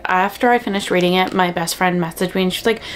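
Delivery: normal talking voice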